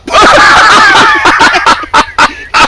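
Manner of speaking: high loud burst of laughter; loud laughing shout. Real, not acted. Man laughing as the dog ignores him, loud high burst. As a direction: explode into a laughing yell. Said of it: "when I say back".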